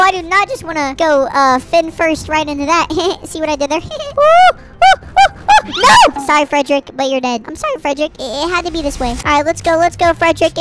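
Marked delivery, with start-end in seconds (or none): 3.8-5.6 series of high, loud hoots; alarmed oohs. Real, not acted. Gamer nearing disaster in the game, alarmed oohs. 5.7-6.2 high, loud cry of no; dismayed yell. Real, not acted. Gamer losing the fish, dismayed yell. Loses in the game.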